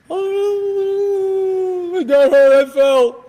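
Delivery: high mock wail, drawn out; loud, hammed-up tearful whine; crying child. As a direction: imitate a hurt kid bawling. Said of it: "they're gonna be crying".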